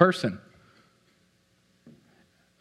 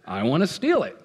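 usual speaking voice

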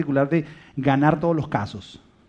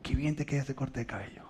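soft compliment demo; 3 semitones lower, 12 dB quieter than usual. Shown as a demonstration. Examples of compliments.

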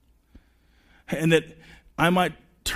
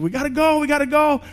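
high, hurried mock wife urging; wife hurrying him out the door, high mock urgency; impatient wife; 11 semitones higher, 5 dB louder, faster than usual. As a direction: squeak it out like a rushed wife. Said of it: "My wife's like".

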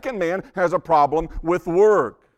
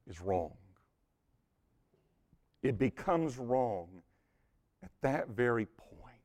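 hushed warning; 4 semitones lower, 10 dB quieter than usual. Acted. Solemn warning, dropping to hush.

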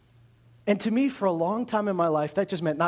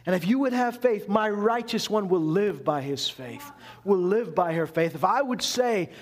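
ordinary delivery

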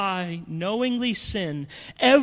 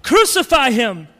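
loud crowd demand to crucify; crowd cry; 5 semitones higher, 7 dB louder than usual. Retold or imitated. Preacher voicing the mob, a loud cry. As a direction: shout it as the mob.

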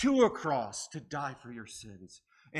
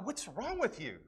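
mocking disbelief, pitched up high; incredulous friends; 7 semitones higher than usual. Party friends mocking his new faith, incredulous. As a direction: tease him like he's lost his mind. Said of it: "And they're like".

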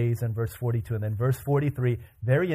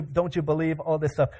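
ordinary delivery

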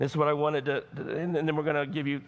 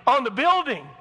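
much higher, loud, demanding whine; mock churchgoer; 11 semitones higher, 8 dB louder than usual. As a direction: whine like a spoiled member. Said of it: "Oh, yeah, I'm going there".

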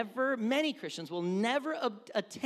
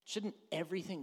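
ordinary delivery

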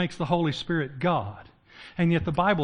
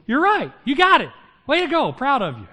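much higher and louder, teasing cheerleader praise; mock praise; 8 semitones higher, 6 dB louder than usual. Jesus mockingly praising the Pharisees, cheery and teasing. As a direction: pat them on the head like a coach. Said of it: "And Jesus goes".

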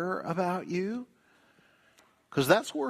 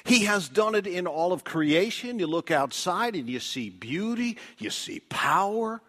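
usual speaking voice

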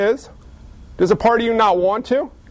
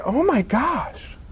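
slow, soft, awestruck words; hushed amazement; 3 semitones higher, 5 dB quieter, much slower than usual. Acted. Neighbor watching the miracle from the window, soft stunned amazement. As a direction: draw it out softly in wonder.

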